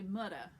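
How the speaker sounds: "mudder" with a hard d and no final r; New York "mudder", the middle consonant tapped hard like "mudda". Shown as a demonstration. Coach shows New York speech to actors, d-for-th "mudder". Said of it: "they say mudder".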